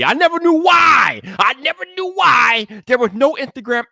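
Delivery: high, loud cry of disbelief; real exasperation; exasperated outburst. Real, not acted. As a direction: throw it up in frustration. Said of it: "exclaimed lament".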